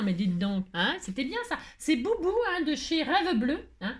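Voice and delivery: ordinary delivery, nothing marked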